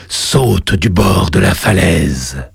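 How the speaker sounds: deep, slow, echoing demon god; cavernous voice. Acted. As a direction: boom it up from the lava below. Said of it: "une voix caverneuse".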